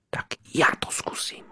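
timid resolved whisper. Acted. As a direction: steel yourself, barely voiced. Leaf resolves to call. (nesmělý odhodlaný šepot)

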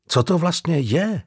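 ordinary delivery

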